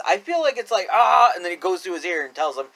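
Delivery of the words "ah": loud, higher mock groan; imitated wrestler noise. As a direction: fake wrestler cry of effort. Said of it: "makes a noise".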